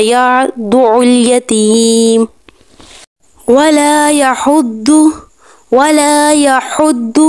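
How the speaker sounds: slow melodic tajweed chant; Quranic recitation. Shown as a demonstration. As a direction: recite slowly with full tajweed. (آہستہ تجوید کے ساتھ تلاوت)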